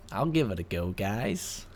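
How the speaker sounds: put-on light Canadian accent, half-joking. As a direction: try out a Canadian accent for fun. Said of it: "He wants me to do a Canadian accent".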